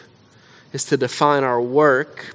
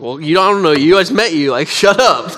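flustered retort; 4 semitones higher, 7 dB louder than usual. Retold or imitated. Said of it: "I was like".

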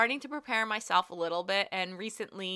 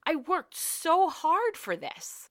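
baffled disbelief; 5 semitones higher than usual. Retold or imitated. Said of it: "And I looked at him like".

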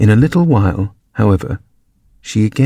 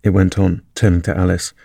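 ordinary delivery

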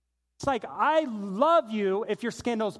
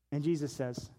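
ordinary delivery

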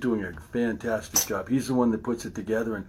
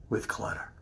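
breathy trailing whisper; 10 dB quieter than usual. Real, not acted. Telling viewers about clutter, sinking to a whisper. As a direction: let it fall away to a whisper.

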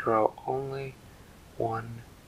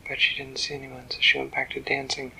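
ordinary delivery, nothing marked

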